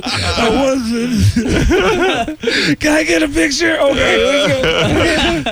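high-pitched blubbering impressions; mock crying. Starstruck comedian doing impressions for quarterbacks, mock crying. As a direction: sob hammily through every word. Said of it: "every impression I did was of somebody crying".

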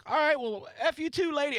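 high-pitched retort, acted out; mock defiant comeback. Comic fires back at the old lady, high mock defiance. Acts his comeback to the heckler.

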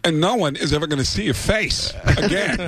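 a hint of Walken's menace, measured and cold; Christopher Walken impression. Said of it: "he stands up and goes".

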